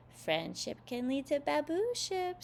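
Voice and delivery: high, slow dreamy voice; dreamy Sally. A narrator's reading. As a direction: say it lovestruck and knowing. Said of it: "Sally told him".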